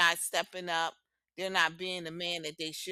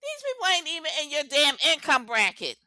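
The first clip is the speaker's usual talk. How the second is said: loud, raised-pitch declaration to the back row; emphatic call-out; 7 semitones higher, 6 dB louder than usual. Real, not acted. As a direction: project it so everyone at the back hears. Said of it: "say this again for the people in the back".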